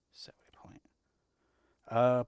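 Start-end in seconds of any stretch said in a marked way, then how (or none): 0.1-0.8 whispering. Real, not acted. Whispering to himself.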